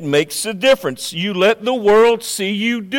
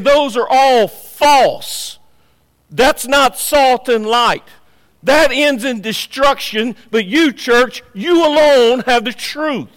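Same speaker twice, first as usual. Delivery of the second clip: raised, loud preaching voice, weighty at first; emphatic pulpit verdict; forceful declaration; 5 semitones higher, 4 dB louder than usual. Acted. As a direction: drive each phrase home to the congregation. Preacher voices Jesus condemning false religions.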